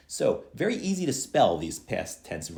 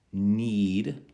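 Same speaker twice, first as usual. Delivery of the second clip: ordinary talk, nothing marked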